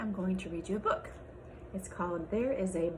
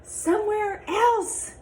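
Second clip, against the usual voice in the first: lively picture-book read-aloud with character voices; reading to children; 4 semitones higher than usual. Acted. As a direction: read it to a class of little kids.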